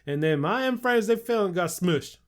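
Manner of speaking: put-on hillbilly accent. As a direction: play a rowdy West Virginia hillbilly. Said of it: "like, hillbilly accent?".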